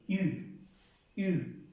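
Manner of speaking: "iu" said fast, the i barely there; quick iu for French U. Shown as a demonstration. Teacher shows students the French U sound. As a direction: slide from i into u quickly. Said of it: "que no se note".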